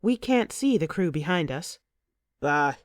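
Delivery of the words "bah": dismissive. A narrator's reading. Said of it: "he says dismissively".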